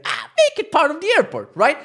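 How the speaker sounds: high, loud exasperated yell; exasperated outburst. Retold or imitated. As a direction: burst out in high-pitched protest. Ranting at the parking's distance.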